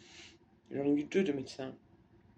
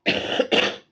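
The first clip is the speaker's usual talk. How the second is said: short dry cough; real cough; 8 dB louder than usual. Real, not acted. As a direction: cough once, loudly. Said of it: "je tousse un peu".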